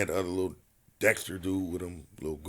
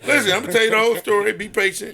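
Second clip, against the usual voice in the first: louder, higher and fast, cutting off the doubters; insistent loud protest; 12 semitones higher, 11 dB louder than usual. Real, not acted. To friends doubting his story, loud insistence. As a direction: talk over them and demand patience.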